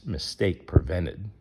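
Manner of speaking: ordinary delivery, nothing marked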